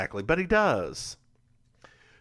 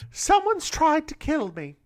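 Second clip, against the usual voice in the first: put-on high, slow and loud old lady telling her fears; 6 dB louder than usual. Old woman tells JB someone tries to kill her, alarmed. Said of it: "why am I doing this?".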